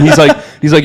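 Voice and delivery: ordinary delivery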